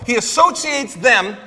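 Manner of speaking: normal talking voice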